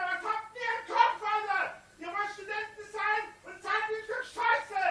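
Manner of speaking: furious screaming; berserk rage. Real, not acted. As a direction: scream at them, out of control. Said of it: "Ich schrei nur deswegen".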